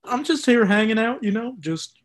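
put-on Texas farm-boy accent; casual Texas country accent. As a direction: play the homesick Texan, accent and all. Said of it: "do, like, a Texas accent".

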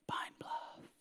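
faint unvoiced whisper; a still small voice, barely there; God's whisper. God whispering the answer to the preacher, hushed. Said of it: "God began to whisper to me".

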